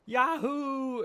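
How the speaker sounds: loud, high, joyful holler; gleeful whoop. Acted. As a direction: whoop like you're flying on a swing. Luffy whooping on the swing.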